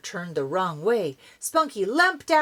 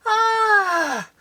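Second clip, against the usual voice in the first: much higher, louder scared cry; startled scream; 13 semitones higher, 8 dB louder than usual. Acted. Dog snatched by the eagle, startled scream. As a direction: cry out in sudden fright.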